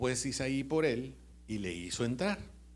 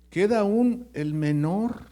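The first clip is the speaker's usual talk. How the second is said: "Queda aún el menor", lento y fuerte, subiendo en "aún"; slow emphatic answer; 6 semitones higher, 7 dB louder, slower than usual. Retold or imitated. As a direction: marca despacio "aún" y "menor". ("Queda aún el menor" dicho lento y enfático)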